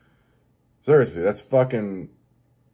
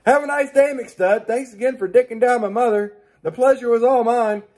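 much higher, sugary polite send-off; mock polite farewell; 11 semitones higher, 5 dB louder than usual. Taunting the rude door-shutter with sugary mock politeness. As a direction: purr it like a saccharine host. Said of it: "in a nice and fucking polite way".

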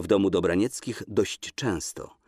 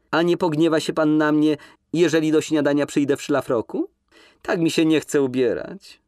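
louder and higher, calling across the room; calling after him; 8 semitones higher, 5 dB louder than usual. Acted. Woman calling after the man leaving the bathroom, louder and higher. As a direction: call out after him as he leaves. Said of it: "zawołała jeszcze za nim".

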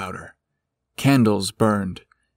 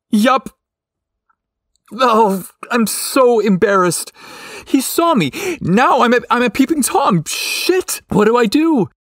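high, loud embarrassed wail; mortified panic; 12 semitones higher, 4 dB louder than usual. A narrator's reading. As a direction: panic at being caught peeping. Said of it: "Bryce was paralyzed with embarrassment".

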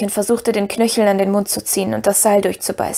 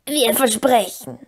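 slow, drawn-out creature voice; Gollum; 2 semitones higher, slower than usual. A narrator's reading. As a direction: promise slowly as a cringing creature.

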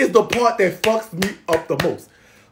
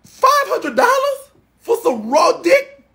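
much higher, slow incredulous squeal; outraged disbelief; 12 semitones higher than usual. Real, not acted. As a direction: stretch it out in shock.

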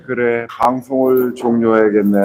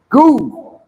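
high yelled go; 10 semitones higher than usual. Pretend. High far yell on swing.

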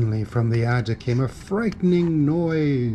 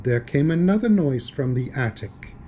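ordinary delivery

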